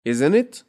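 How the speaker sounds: confident tag question; rhetorical tag. Shown as a demonstration. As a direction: push isn't it as sure emphasis. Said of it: "rhetorical question".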